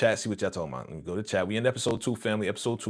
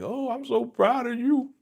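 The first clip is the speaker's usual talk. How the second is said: much higher, sing-song proud gushing; gushing Donna. Retold or imitated. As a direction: coo it like a proud, scheming mama. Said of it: "and she like".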